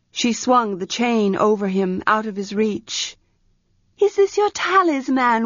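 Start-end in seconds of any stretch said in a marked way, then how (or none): 4.0-5.5 put-on higher, urgent young priestess; a young priestess pressing for an answer; Arha's voice. Young priestess Arha urgently asks her servant for a room.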